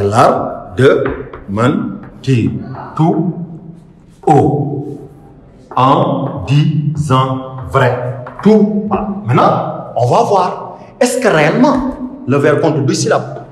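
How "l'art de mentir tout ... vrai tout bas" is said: very slow, each syllable held apart with pauses; spaced-out syllable count of a verse. Shown as a demonstration. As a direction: break the verse into separate, drawn-out syllables. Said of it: "il faut tout faire pour le décomposer".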